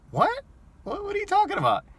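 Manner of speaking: squeaky high, fast what-are-you-talking-about; shocked disbelief. Retold or imitated. Driver reacting to passenger's crude comment, shocked high yelp. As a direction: recoil in disbelief, voice jumping way up.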